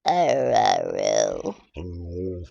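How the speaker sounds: low, drawn-out and fading; low goofy dog voice; deep silly love voice. Pretend. Deep put-on voice to pet.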